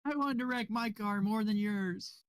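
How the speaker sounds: mock driver excuse.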